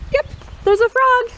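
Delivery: high, loud, bright find; excited reveal. Real, not acted. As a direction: cheer that they spotted it. Kids found the hidden frog.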